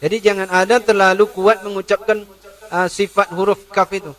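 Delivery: ordinary talk, nothing marked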